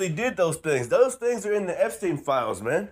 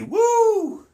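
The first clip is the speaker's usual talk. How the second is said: high, loud joyful whoop; real exultant cheer; excited whoop; 5 dB louder than usual. Real, not acted. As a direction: whoop with triumph. Exulting over scripture.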